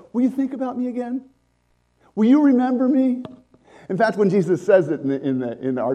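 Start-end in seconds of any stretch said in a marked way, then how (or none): 0.1-3.2 mock wistful plea. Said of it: "to remember me just means".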